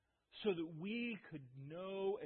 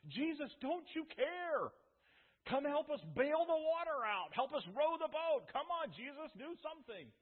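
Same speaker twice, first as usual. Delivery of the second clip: higher, louder, desperate pleading to be saved; panicked plea; 8 semitones higher, 6 dB louder than usual. Acted. Panicking disciples begging the sleeping Jesus to act, high and loud. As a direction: cry out like men about to drown.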